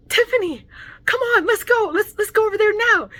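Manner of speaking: very high, loud mimicked pleading to hurry; excited urging. Friend urges her over to the drive-through, imitated excited urging. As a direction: imitate an impatient fan pestering a friend.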